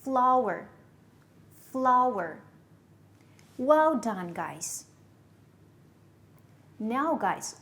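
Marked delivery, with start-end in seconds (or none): 0.0-2.4 "Flower" with FLOW hit firmly, then falling away; stress demonstration. Shown as a demonstration. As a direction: punch the first syllable of "flower" each time. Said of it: "Look at the stress".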